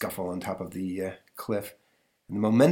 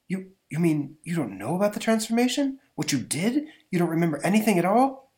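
lightly raised disbelieving voice; a hint of stunned professor; incredulous professor; 9 semitones higher, 3 dB louder than usual. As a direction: ask in disbelief. Bennett incredulous.

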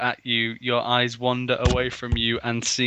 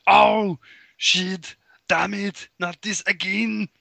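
screaming Arnold; 8 semitones higher, slower than usual. Acted. Arnold free falling again, hammed-up scream. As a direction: yelp it in hammed-up dismay. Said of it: "Arnold screamed out".

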